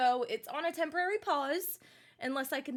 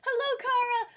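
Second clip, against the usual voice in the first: high singsong greeting; 11 semitones higher, 4 dB louder than usual. Real, not acted.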